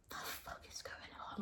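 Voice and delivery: hushed, breathy disbelief; a stunned whisper at the door; shocked whisper. Retold or imitated. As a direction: whisper it so the phone call can't hear you. Stunned at crying, screaming scene.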